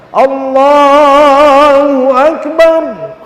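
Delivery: high, loud, very slow echoing takbir. Retold or imitated. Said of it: "Mubalaghahnya lebih keras lagi". (takbir bilal yang lebih keras dan tinggi)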